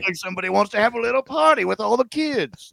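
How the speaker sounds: high, teasing folksy tone; mock Southern voice. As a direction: keep the joke going. Continuing the bit.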